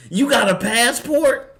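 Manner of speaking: shrill, high, loud sneering clerk voice; mock her disbelief, pitch way up; a nasty clerk in a hammed-up squeal. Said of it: "That's what she would have said to Lewis Hamilton".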